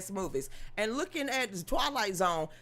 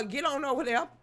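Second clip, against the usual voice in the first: normal talking voice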